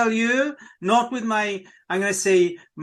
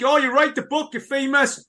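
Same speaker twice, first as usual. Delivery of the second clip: mock naive voice; 6 semitones higher than usual. Mocking people's naive belief.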